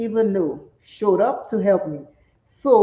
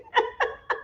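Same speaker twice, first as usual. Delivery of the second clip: soft laugh; 6 dB quieter than usual. Real, not acted. Laughs after telling off ladies.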